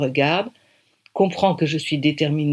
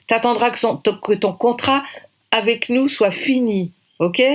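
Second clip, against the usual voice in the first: higher, firm voice laying down the law; a producer shutting down a reckless plan; firm, pointed warning; stern producer; 6 semitones higher than usual. Acted. Producer Audrey scolds the girl.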